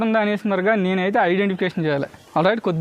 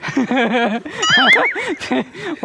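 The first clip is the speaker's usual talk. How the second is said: laughing; 7 semitones higher than usual. Real, not acted. Laughs at the phone bet.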